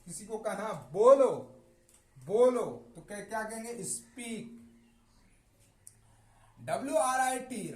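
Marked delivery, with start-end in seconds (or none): 0.7-2.9 बोलो called out much louder; teacher acting out a loud command to speak. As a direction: order someone to speak, loudly.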